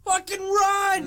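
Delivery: high, drawn-out scream to flee; imitated desperate yell. Babysitter yells to the kid to escape, imitated desperate scream. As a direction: yell it long and high, acting her out.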